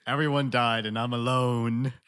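hammed-up despair; mock tragic hero. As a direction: ham it up like a doomed film hero. Joking melodrama about film sequel.

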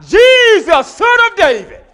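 loud high shout; yelled. Retold or imitated. Beggar yelling again for Jesus, loud. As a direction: cry out over the crowd. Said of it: "But he yelled out".

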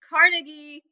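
Carnegie stressed on the first syllable, CAR-nuh-gee. Shown as a demonstration. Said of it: "I've heard it mentioned both ways".

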